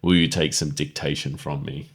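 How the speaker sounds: imitating a pushy boss, understated; aggressive come-on. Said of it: "leans in real aggressively and says".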